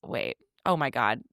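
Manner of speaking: normal talking voice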